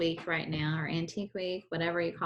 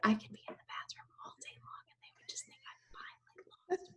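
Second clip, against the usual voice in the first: whisper; 12 dB quieter than usual. Real, not acted. Jokes about hiding in bathroom.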